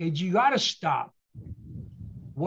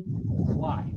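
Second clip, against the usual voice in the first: ordinary talk, nothing marked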